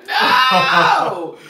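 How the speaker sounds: slow, loud, drawn-out no; shocked shout. Retold or imitated. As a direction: cry out the no in shock. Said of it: "I was like".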